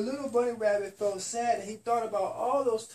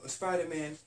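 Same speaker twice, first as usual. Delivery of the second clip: ordinary delivery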